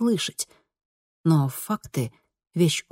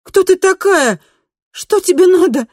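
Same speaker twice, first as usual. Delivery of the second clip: loud, high, panicked challenge; nervous shouting; 8 dB louder than usual. A narrator's reading. Kristina confronting her double in the doorway, nervous shout. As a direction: shout it in rising alarm at the impostor. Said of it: "нервно выкрикнула Кристина".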